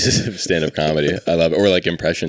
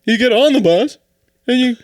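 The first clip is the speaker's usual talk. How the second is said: very high, loud comedian impression; slow, halting, exaggerated observational bit; Seinfeld; 6 dB louder, slower than usual. Comic puzzling over bus and plane, high loud impression. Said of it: "Seinfeld gets on and goes".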